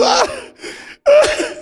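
high, drawn-out "Ah!" cries; acted scream of pain. Preacher acting out Malco screaming, anguished cries. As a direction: cry out in acted agony, very high. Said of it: "Malco está gritando".

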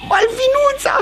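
high-pitched yearning cry; high, swooning exclamation; lovesick exclamation. Acted. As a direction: cry out the beloved's name. Lovesick Pierrot cries her name.

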